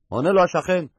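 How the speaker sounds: ordinary talk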